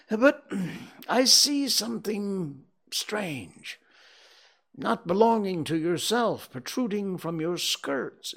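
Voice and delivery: high, slowing to a fearful question; uneasy Scrooge voice. Acted.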